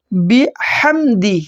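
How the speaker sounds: slow বিহামদি with a breathy হা; throaty big ha. Shown as a demonstration. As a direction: draw it out, ha from the throat. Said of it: "গলায় শোঁ শোঁ শব্দ করে পড়তে হবে". (ধীরে গলার হা দিয়ে বিহামদি)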